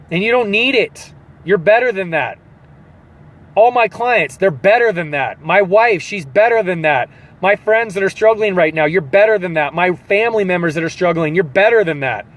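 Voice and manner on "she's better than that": usual speaking voice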